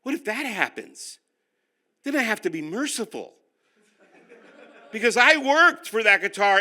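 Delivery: normal talking voice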